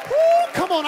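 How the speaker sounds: high, loud woo of praise; praise shout. Real, not acted. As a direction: whoop it up for the praise break.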